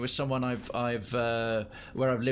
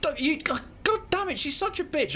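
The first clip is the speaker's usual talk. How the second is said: much higher mock-furious outburst; exasperated outburst. Real, not acted. Streamer annoyed at the robot's fake alarm, high exasperated outburst. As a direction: vent at being wound up, pitch soaring.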